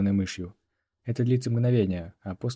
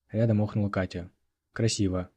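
usual speaking voice

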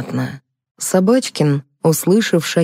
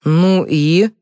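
pointed, threatening prompt; mildly menacing challenge. A narrator's reading. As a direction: press for more with a hint of threat. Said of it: "с угрозой произнёс Сеня".

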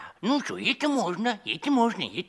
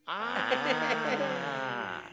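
normal talking voice

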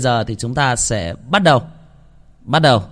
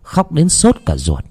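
louder, measured storytelling voice; horror story narration; 5 dB louder than usual. A narrator's reading. Narrator telling listeners the creepy scene, in a dark storytelling voice. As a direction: read it like a spooky tale by night.

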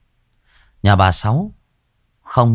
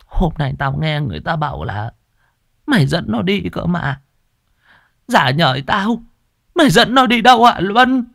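put-on high, rising old woman's scolding; scolding old mother; 11 semitones higher, 3 dB louder than usual. Old mother presses son about the dog, high and rising. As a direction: press him hard like an angry old mother.

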